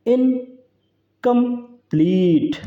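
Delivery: Incomplete very slowly split, high, pleat stretched; stressed final syllable. Shown as a demonstration. Teacher showing an anapaest to students, incomplete with long stressed pleat. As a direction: separate इन, कम, then hold pleat long and falling. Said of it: "ये जो है long level है".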